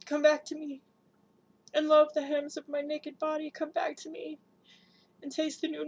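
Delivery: loud, high calling out; desperate cry. Acted. Mother crying out for her blue child, loud and desperate. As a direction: call her back with everything.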